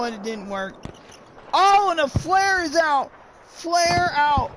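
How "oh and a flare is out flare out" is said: excited. Real, not acted. Caster excited by flare.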